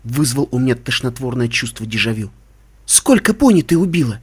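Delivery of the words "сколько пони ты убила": raised, pointed accusation; reproachful question pitched up; condemning. A narrator's reading. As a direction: demand an answer as an accuser. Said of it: "Её осуждающий тон".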